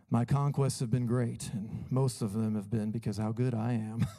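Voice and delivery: low, soft, smug self-praising pastor; mock a proud preacher who loves himself; hammed-up boastful pastor; arrogant pastor parody. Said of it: "When you hear people talk like this".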